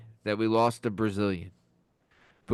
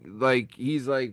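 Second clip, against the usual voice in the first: ordinary talk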